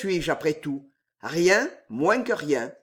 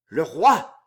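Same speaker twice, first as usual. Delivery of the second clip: startled, growled exclamation; a shocked growl; 6 dB louder than usual. Acted. Damiens growls at his master. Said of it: "gronda Damiens en pâlissant".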